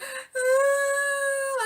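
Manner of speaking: hammed-up baby wail; mock baby crying. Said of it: "I was crying like a big baby".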